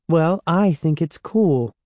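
defensive. A narrator's reading. Andy defending the monster blood, mildly defensive. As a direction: stick up for the stuff a little. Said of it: "Andy said defensively".

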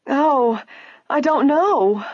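high, distressed wailing; woebegone wail. A narrator's reading. Clerk staring at the torn dress, woebegone wail. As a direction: wail helplessly. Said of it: "the woebegone clerk wailed".

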